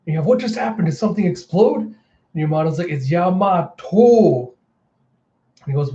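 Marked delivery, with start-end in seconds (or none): 0.3-1.9 startled confusion. Retold or imitated. Acted startled bystander. 2.9-4.5 mad correction. Retold or imitated. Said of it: "As Yamato's getting mad".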